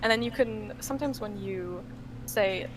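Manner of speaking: normal talking voice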